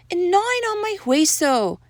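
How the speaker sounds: high little doggy voice; small amigo complaint. A narrator's reading. Sixth amigo complains in story.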